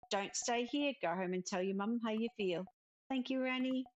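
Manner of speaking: ordinary delivery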